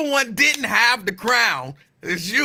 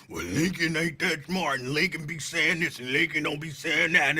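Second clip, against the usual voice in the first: mock badmouthing; 6 semitones lower, 4 dB quieter than usual. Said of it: "other places you".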